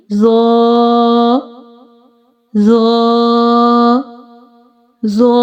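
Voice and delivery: "Za" held long on a level note; read-after-me model. Shown as a demonstration. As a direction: draw out "za" for learners to copy. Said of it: "Please read after me".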